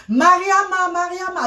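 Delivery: high call trailing off as an echo. Retold or imitated. Mother calling her daughter from the sky, echoing and high. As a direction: call her name from far away. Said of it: "avec écho".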